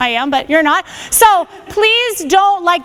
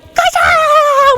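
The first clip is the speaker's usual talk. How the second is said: much higher, louder gotcha; hammed-up grab cry; mock attack yell; 8 semitones higher, 4 dB louder than usual. Instructor mocking martial arts moves, hammed-up yell. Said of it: "We're like".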